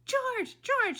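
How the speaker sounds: high, loud frantic shout. A narrator's reading. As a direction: shout desperately for your husband. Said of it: "she shouted".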